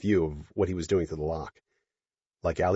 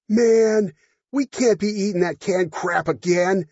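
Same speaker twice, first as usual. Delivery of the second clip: put-on drunk guy voice; 13 semitones higher, 7 dB louder, slower than usual. Drunk accountant speaking.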